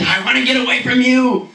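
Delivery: mock exasperated inner voice. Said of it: "what you really wanna say is".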